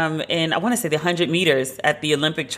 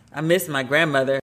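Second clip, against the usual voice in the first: ordinary talk, nothing marked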